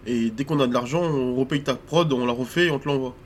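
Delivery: usual speaking voice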